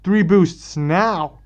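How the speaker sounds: loud, drawn-out urgent command; nervous yell. Acted. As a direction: yell out an order under pressure. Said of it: "gets kind of nervous and yells".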